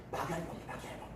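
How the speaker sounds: hushed, quick whispered name-calling; secretive whisper. Acted. Pupils nicknaming their teacher behind her back, sly whisper. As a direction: whisper it like gossiping classmates.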